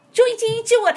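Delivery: a sick woman forcing out her declaration; shouted declaration. Retold or imitated. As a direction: belt it out as a forced shout. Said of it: "他就喊一嗓子".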